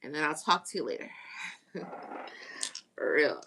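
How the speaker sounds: ordinary talk, nothing marked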